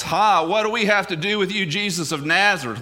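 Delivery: preacher's restrained imitation of a loud cry; mild demon outcry. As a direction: cry out lightly as a possessed man. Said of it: "he cried out in a loud voice".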